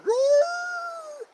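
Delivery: high, loud howl like a dog at a siren; big mock siren howl; imitated dog howl. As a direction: howl it out like the neighborhood dogs. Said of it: "all the dogs start going".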